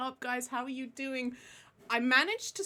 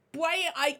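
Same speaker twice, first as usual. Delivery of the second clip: ordinary delivery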